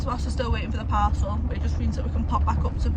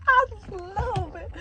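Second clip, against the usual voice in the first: dreamy gushing, drawn out slowly; gushing, higher and slower; 9 semitones higher, slower than usual. Retold or imitated. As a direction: sigh it out, swooning, much higher. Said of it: "I'm like".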